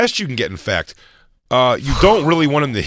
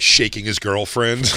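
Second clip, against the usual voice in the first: ordinary talk, nothing marked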